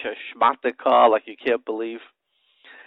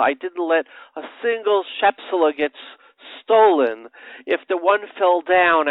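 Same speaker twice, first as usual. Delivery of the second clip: preaching with force; 4 semitones higher, 7 dB louder than usual. Acted. Preacher voicing Jacob's toil to the listeners, fervent and louder. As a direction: drive the verse home with rising fervor.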